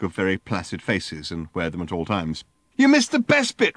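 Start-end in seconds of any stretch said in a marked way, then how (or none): 2.8-3.7 high, loud outrage; raging. Acted. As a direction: rage at him for missing it. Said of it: "raged Ford".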